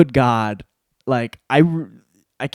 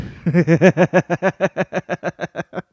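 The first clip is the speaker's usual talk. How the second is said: long, staccato mock laugh; gloating laugh; 5 semitones higher, 4 dB louder than usual. Imagined champ refusing a rematch, gloating cackle.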